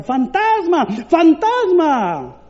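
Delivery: high mock-scared cry of ghost, drawn out slow at the end; frightened cry. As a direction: cry out like a terrified sailor. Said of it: "comenzaron a gritar". (grito asustado, agudo y alargado)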